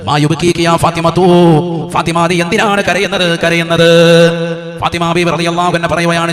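loud chanting narration on a level pitch; intoned sermon storytelling. A narrator's reading. Preacher tells of dying Fatima weeping, loud intoned narration. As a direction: recite the sacred story in a sustained preacher's chant, stretching line endings.

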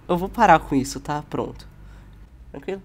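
normal talking voice

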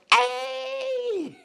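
very high squeal of greeting; cheerful hey. Retold or imitated. Auntie Sarah answering the driver, high excited greeting. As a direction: beam back at the caller. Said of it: "she was like".

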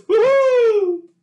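very high, very loud whoop; mighty cheer. A narrator's reading. Villagers cheering over the hot loaf, very loud high whoop. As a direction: cheer like a whole crowd. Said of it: "raised a mighty shout".

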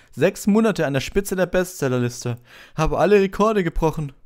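preen over your fan mail; Lockhart voice. A narrator's reading. Lockhart boasts about a fan to Harry, high and pompous.

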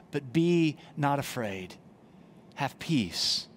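ordinary talk, nothing marked

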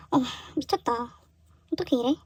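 softly whispered disbelief; awed whisper. Real, not acted. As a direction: breathe it out, too moved to speak aloud. Overwhelmed by gift.

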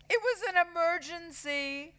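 very high whiny voice; high, innocent protest; boy's pleading excuse. A narrator's reading. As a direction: plead it like a caught schoolboy. Second caught boy backs the excuse.

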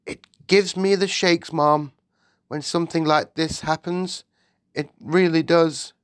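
high, anxious servant voice; shaken maid. Acted. The maid tells the Queen it gives her shakes, shaken. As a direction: confide nervously.

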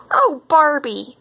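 high, drawn-out, delighted exclamation; thrilled storybook cry. A narrator's reading. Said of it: "cried Missus Appleberry".

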